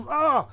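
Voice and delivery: high sudden yelp as if jabbed; mock yelp. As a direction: yelp like a needle just hit you. Auto-injection joke, shout.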